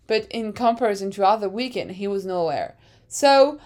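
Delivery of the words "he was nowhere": ordinary talk, nothing marked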